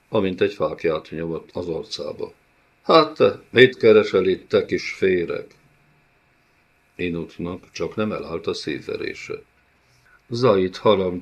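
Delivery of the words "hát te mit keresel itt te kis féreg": scornful challenge. A narrator's reading. Giant shoves a torch at the prince, scornfully challenging. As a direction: confront an intruder loudly, with contempt. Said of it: "horkant fel egy óriás".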